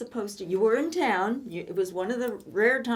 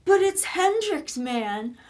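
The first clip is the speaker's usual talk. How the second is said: put-on excited boyfriend; 6 semitones higher, 6 dB louder, slower than usual. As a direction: mimic a starstruck young guy raving. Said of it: "And you go".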